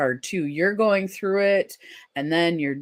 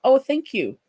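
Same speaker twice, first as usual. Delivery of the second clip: usual speaking voice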